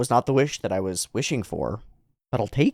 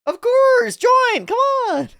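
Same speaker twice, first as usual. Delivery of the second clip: very high, loud squeaky cheers; overeager boy believer; 5 dB louder, slower than usual. Acted. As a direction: squeal an eager welcome. Character eagerly welcomes recruit.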